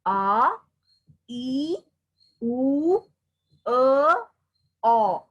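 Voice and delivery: A, e, oo, e, o each sounded out loud and separate; vowel sounds repeated. Shown as a demonstration. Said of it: "I will just repeat the sounds".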